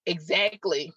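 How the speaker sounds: usual speaking voice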